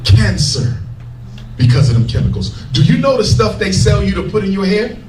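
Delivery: ordinary talk, nothing marked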